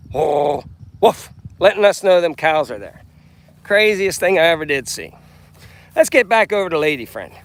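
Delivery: loud rancher, mild west Texas twang; grumpy grandpa voice. Pretend. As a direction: boom it out like a ranch host. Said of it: "I'm acting like I'm on Grumpy Grandpa Patriot Show".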